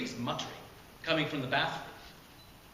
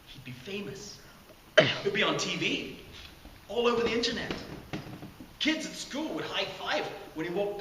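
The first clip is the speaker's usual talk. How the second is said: excited; 6 semitones higher than usual. A narrator's reading. Excited daydream of fame.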